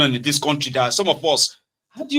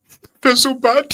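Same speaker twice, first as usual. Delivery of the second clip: mocking, much higher whiny wail, slow; mock emotional mourner; 12 semitones higher, 4 dB louder, slower than usual. Mocking a stranger's online grief, high and slow. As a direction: ham up the fake grief, high and drawn out.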